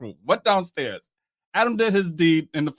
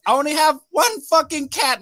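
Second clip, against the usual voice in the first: high-pitched mock crying voice; tearful protest; 10 semitones higher than usual. Woman answering party guests about her cat, mock tearful protest. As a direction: imitate an upset woman wailing in protest. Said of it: "she starts crying even more".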